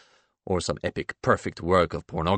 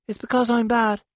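put-on high small-boy voice, no longer shouting; confiding child; 13 semitones higher, 3 dB louder than usual. A narrator's reading. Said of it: "Remington whispers to me confidentially".

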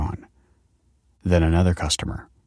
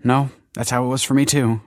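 hasty higher-pitched blurt; a slightly blurted reply; 6 semitones higher than usual. A narrator's reading. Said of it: "blurted Michael".

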